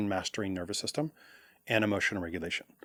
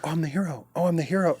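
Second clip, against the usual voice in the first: higher, puffed-up proud man; mock proud hero; 7 semitones higher, 4 dB louder than usual. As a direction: crow like a proud boy who won.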